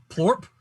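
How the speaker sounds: a short wet pop, lips shaping "plorp"; plorp popping noise. Shown as a demonstration. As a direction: pop it out like a limb snapping off, "plorp". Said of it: "Plorp is the song, is the sound".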